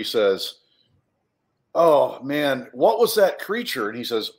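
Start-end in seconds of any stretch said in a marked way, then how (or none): 1.7-3.9 put-on a lightly raised, eager kid voice; curious kid character. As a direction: ask it wide-eyed, a bit louder. Kid character asks in story.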